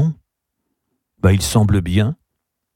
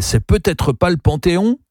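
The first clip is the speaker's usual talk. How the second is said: higher-pitched protesting question; indignant cry; 8 semitones higher than usual. A narrator's reading. Gabriel disputing Charles over the Panthéon, indignant cry. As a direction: challenge it, rising at the end. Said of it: "cria Gabriel".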